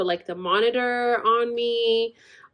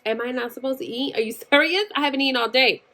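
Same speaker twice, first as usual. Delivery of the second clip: ordinary delivery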